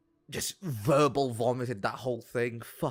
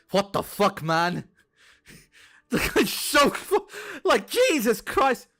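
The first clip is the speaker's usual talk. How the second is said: loud, high exasperated yelling; incredulous outburst; 9 semitones higher, 8 dB louder, slower than usual. Real, not acted. Streamer recalling girlfriend's revelation, loud incredulous outburst. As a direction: burst out in disbelief, breaking off mid-thought.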